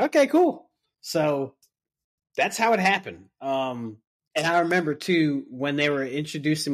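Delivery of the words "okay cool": much higher and louder, startled; a taken-aback okay, cool; surprised okay. Retold or imitated. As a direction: blurt it out, caught off guard. Reacting to surprise membership news.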